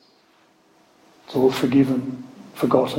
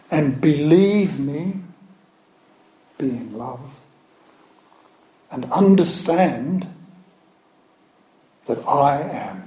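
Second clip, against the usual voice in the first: raised, solemn proclaiming of scripture; scripture reading; 3 semitones higher, 3 dB louder, slower than usual. Acted. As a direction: declaim it like a prophet before a congregation.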